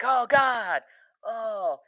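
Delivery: high mock wail to God; retold hollering. Prisoner in the back of the car, mock hollering. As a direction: holler it high, imitating a desperate plea. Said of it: "Boyd starts hollering in the back of the car".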